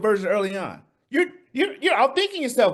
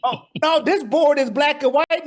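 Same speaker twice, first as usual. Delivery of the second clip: normal talking voice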